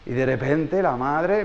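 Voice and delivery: ordinary talk, nothing marked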